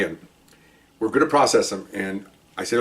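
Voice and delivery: ordinary talk, nothing marked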